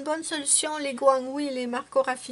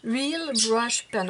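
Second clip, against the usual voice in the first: slow, careful English with a French accent; slower than usual. Real, not acted. Vlogger attempting an English product name, careful French-accented English. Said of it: "On ne rit pas de mon accent anglais".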